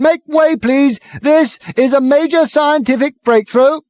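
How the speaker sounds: high, loud megaphone bawl; bawling through megaphone. Acted. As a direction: order the crowd about. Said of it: "bawling at the crowd through it".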